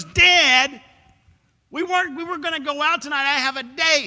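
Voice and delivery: put-on high, loud teenage protest; teen girl pleading she has a date; whiny teenage daughter. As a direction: whine back at your father.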